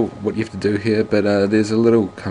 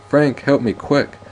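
cry for help; 7 semitones higher, slower than usual. Acted. Calls for help.

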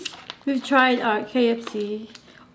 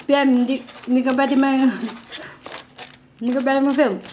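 mock scolding Filipino; 3 semitones higher, 3 dB louder than usual. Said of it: "I was like".

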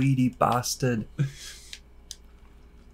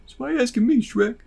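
Donkey voice; 11 semitones higher, much faster than usual. Pretend. High voice answering Shrek.

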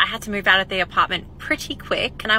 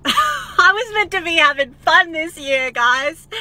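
higher, louder, laughing lament; 8 semitones higher, 5 dB louder, slower than usual. Real, not acted. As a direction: laugh through the irony. Laughing ironic complaint.

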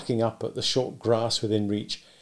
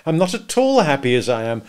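put-on louder and higher, exasperated young man; impatient exclamation; 9 semitones higher, 6 dB louder than usual. A narrator's reading. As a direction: snap it out impatiently, slowing on the last words. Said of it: "I exclaimed impatiently".

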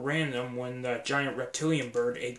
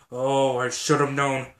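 ordinary delivery

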